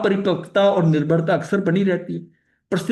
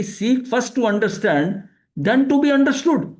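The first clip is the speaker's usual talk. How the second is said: slow emphatic quoting; 4 semitones higher, much slower than usual. Shown as a demonstration. Teacher states habit in English.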